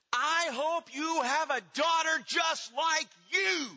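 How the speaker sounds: high, loud, slowed-down furious shout; furious yell. Retold or imitated. Mother screaming at her rebellious teenage daughter, furious shout. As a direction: hurl it at her like a curse. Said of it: "ending the discussion by yelling".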